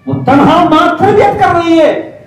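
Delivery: high, loud preacher's cry on تربیت; forceful preaching. Acted. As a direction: drive the point home to the congregation. (پرجوش خطابت، اونچی آواز)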